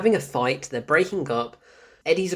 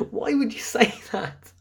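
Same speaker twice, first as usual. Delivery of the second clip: breathy whisper through laughter; laughing whisper; 7 semitones higher than usual. Real, not acted. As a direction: whisper it while cracking up. Laughing disbelief at script.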